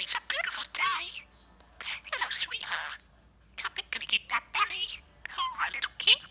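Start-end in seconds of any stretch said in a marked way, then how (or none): none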